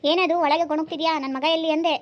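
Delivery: loud, sharp mother-in-law scolding; mother-in-law yelling at her son's wife for coming home late; angry scolding. Acted. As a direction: bark at the late daughter-in-law.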